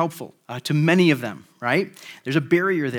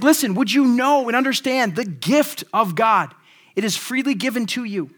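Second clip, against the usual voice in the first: much higher and louder, urgent appeal; pleading call; 8 semitones higher, 6 dB louder than usual. Retold or imitated. Peter urging Simon to receive the free gift, pleading loudly. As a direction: plead with him to understand, full voice. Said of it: "He's like".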